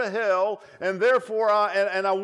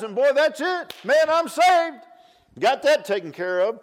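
hammed-up cheer of someone who thinks he's finished; mock triumph; 6 semitones higher, 6 dB louder than usual. Mocking shallow convert's relief.

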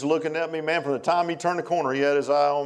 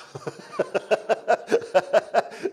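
real laugh; 8 semitones higher than usual. Real, not acted. Laughing at preacher joke.

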